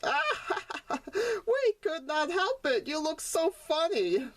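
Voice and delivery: high, laughing teasing voice; laughing brothers. Acted. Brothers laughing at Sonny's slipped crown, teasing. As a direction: giggle it out while mocking.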